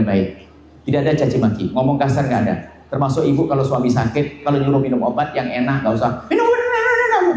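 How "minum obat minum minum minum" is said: much higher, scolding nag with a laugh in it; nagging wife. Acted. Wife nags her sick husband to take medicine, high nagging voice. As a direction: badger him to take his pills.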